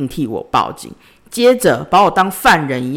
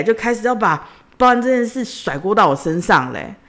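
normal talking voice

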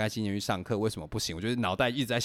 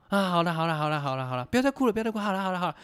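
much higher, repeated gentle hushing; a hint of a fond dad's comforting; imitating a soothing father; 9 semitones higher, faster than usual. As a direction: coax a crying girl to stop. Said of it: "就这样安慰他".

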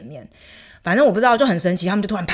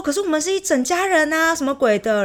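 much higher mock whiny protest; mocking the Turkish family; 9 semitones higher than usual. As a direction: parody their complaint in a high voice. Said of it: "然后他就说". (模仿土耳其家人抱怨，声音尖高)

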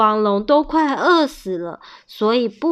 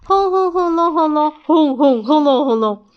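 ordinary delivery, nothing marked

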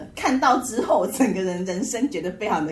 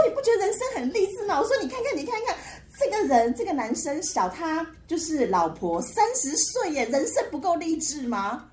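excited; 9 semitones higher than usual. Retold or imitated. Retold gushing to friend.

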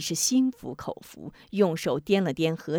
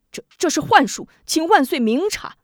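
flustered disbelief; 8 semitones higher, 4 dB louder than usual. Acted. Prince in disbelief pleading.

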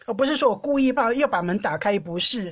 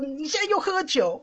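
retold accusation; 6 semitones higher than usual. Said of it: "然后我就说".

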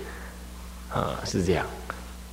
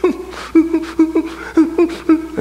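high, closed-mouth whimpers; short pulsed hums, refusing to cry; stifled no-cry. Acted. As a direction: imitate a child holding back sobs. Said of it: "打你们都不哭的".

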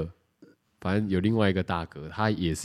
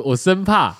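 usual speaking voice

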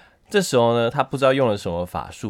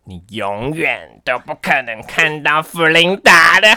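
slow, louder evil witch voice; old hag; 4 semitones higher, 8 dB louder than usual. Acted. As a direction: sneer it like a fairy-tale witch. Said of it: "每一个故事的巫婆的声音都很一致". (巫婆的阴险声音)

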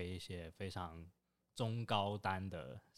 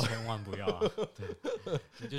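laughing through the words; giggling while giving advice; 3 semitones higher, 6 dB louder than usual. Real, not acted. Laughing at S1's remark. (边笑边说)

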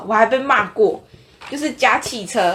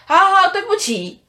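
retold loud apology; 10 semitones higher, 3 dB louder than usual. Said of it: "我就很大声跟他说".

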